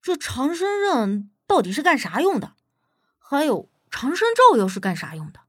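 put-on lively, puzzled young sidekick's questions; curious questioning. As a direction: ask eagerly, with swinging intonation. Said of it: "小贾就好奇地问".

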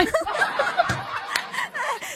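high laugh. Real, not acted.

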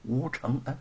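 soft, cautious calling of a name; hesitant, hushed probe; tentative call. Acted. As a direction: test whether he hears you, softly. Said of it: "试探着叫道".

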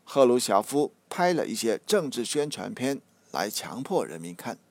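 ordinary talk

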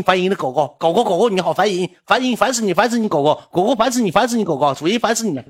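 high, loud, shrill-free nagging rant, repeated; mock angry scolding. Imitating the boyfriend yelling at her over video, high and loud. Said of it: "一顿给我骂".

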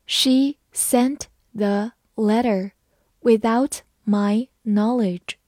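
slow, each word clear; slow reading. Shown as a demonstration. Teacher reads slowly so students can repeat. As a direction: read it slowly for learners. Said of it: "好，我们慢慢来读。".

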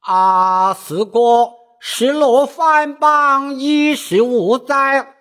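high, slow stylized opera speech; Peking opera recitation. Acted. The sixth brother asks Silang in high, slow opera recitation. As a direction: declaim it like a Peking opera role. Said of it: "六弟问道".

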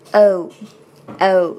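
"O" as a long, full "oh" glide; vowel O demo. Shown as a demonstration. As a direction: sound the "O" slowly and roundly. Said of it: "这里的元音是O". (元音“O”发饱满)

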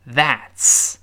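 "that's" with t and s fused into ts; tongue out to start "that's", sharp ts to finish; linked ts ending. Shown as a demonstration. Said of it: "变成了ts这个声音". (“that's”结尾连成ts)

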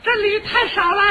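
high, loud mynah complaint; grumbling bird. Acted. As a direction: squawk it like a greedy bird. Bird complains of too little. (尖声学八哥嫌少)

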